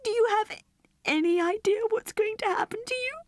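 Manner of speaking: put-on high, hushed child whispering in fear; a girl afraid for her friend; frightened girl. Girl fearful for her teddy.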